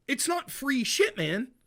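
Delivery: heated retold rant. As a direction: push it up like you're fed up.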